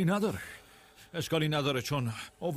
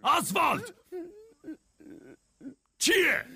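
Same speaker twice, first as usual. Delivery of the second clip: angry yelling. Acted. Calling Oswald over the mess, angry yell. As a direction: yell it in exasperation. Two men yell at each other.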